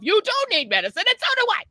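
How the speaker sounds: high-pitched, worked-up mock protest; exasperated inner retort. Patient silently answering the wailing roommate, high exasperated retort. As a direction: argue back in a high, exasperated voice. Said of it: "I'm thinking to myself".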